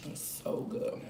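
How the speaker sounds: breathy whisper of delight. Real, not acted. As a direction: whisper it between bites. Whispered while eating.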